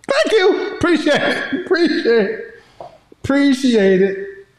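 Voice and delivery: high, light mock thanks; mock grateful voice. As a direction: play the grateful one.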